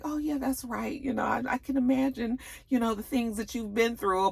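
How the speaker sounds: high soft sympathy; sympathetic classmate. Retold or imitated. Classmate offering sympathy, high soft voice.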